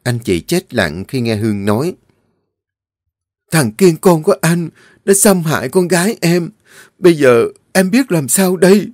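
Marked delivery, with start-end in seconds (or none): 3.5-8.9 high, loud tearful plea; khóc trong uất hận; crying in anguish. Acted. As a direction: break down telling the parents. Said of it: "Cô khóc trong uất hận".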